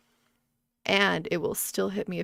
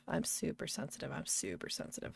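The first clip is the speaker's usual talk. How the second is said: softer, then whispered super sensitive; fading repetition; 4 semitones lower, 11 dB quieter than usual. Shown as a demonstration. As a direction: let each repeat fade down to a whisper. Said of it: "I can say it a lot".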